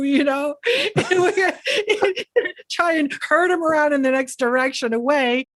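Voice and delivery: ordinary talk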